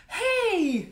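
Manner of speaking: cheery greeting. Retold or imitated. Greeting Mister Miller brightly.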